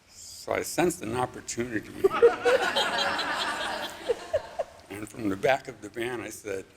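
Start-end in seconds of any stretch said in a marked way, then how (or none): 2.0-4.6 high burst of real laughter. Real, not acted. Laughs at his own joke.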